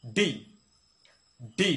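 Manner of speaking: "ডি" with a forceful d burst; correct letter D. Shown as a demonstration. Teacher has learners repeat along, "ডি" punched. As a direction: hit "ডি" hard, each one separate. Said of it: "আবার বলতে পারেন".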